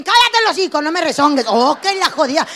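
imitating an angry mom; angry mother. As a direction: snap at the kid like a fed-up mom. Mother scolding daughter.

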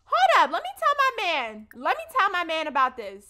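loud, high indignant outburst; outraged. Real, not acted. Pregnant player outraged at Trey John, loud. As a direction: call for backup in disbelief.

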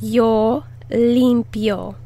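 Yo limpio said slowly, syllable by syllable; slow teaching pronunciation; slow model. Shown as a demonstration. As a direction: draw out yo limpio for learners to copy. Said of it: "Put the emphasis on the first syllable".

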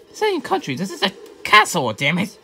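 loud, high-pitched outburst; indignant correction. Acted. Correcting the one who called the castle a country, indignant. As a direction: protest it loudly, pitch jumping up.

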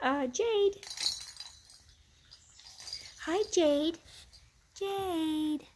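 a high cooing pet voice; cooing at puppies. Real, not acted. Greeting the puppies affectionately.

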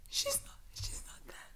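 whispered through tears, barely voiced; tearful whisper. Real, not acted. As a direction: breathe the denial out, choked up. Crying over a character's death.

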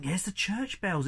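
ordinary delivery

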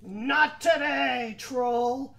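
loud, slow, defiant goat; biggest billy goat. A narrator's reading. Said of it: "and the billy goat said".

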